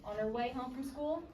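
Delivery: ordinary delivery, nothing marked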